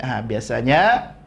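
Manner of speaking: ordinary delivery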